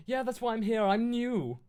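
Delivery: high, loud chirpy eagerness; eager and delighted; happy. Acted. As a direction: blurt it out happily, pitched right up. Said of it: "I smelled happily".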